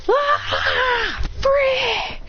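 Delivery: high, drawn-out cry of release; acted cry. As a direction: cry out high, as a story character. Character cries out as train comes.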